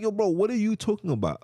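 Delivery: high-pitched, exasperated question; baffled comeback. Retold or imitated. Challenging the brother on his claim, baffled. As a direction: throw it up high in disbelief.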